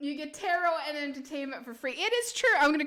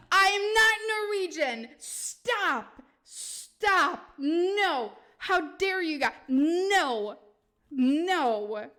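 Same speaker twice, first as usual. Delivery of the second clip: mock outrage; 4 semitones higher, 6 dB louder, much slower than usual. Real, not acted. Denying being Norwegian, mock outrage.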